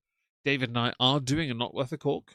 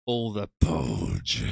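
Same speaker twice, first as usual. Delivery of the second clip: drawn-out; 6 semitones lower, 3 dB louder, much slower than usual. Pretend. Drawn-out mock reading.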